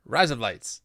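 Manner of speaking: words blended into an Australian accent. Shown as a demonstration. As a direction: run together like Australian "razor blades". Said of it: "in an Australian accent".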